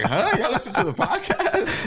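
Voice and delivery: high-pitched, laughing disbelief; retold double take, voice shooting up; incredulous surprise. Groom learning the wife's family listens, incredulous.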